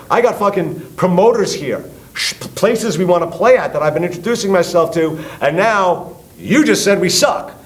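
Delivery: loud, high indignant tirade; retold furious dressing-down; outraged rant. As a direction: berate him for the damage done, slowing on the insult. Acted angry rant at the insulter.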